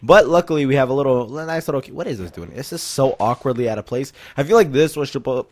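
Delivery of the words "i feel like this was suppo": ordinary talk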